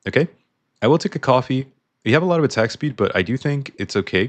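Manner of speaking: ordinary delivery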